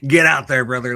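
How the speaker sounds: loud laughing rally cry; laughing hype. Real, not acted. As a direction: cheer him on, laughing. Laughing, hyping the player.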